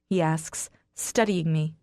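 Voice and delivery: ordinary delivery, nothing marked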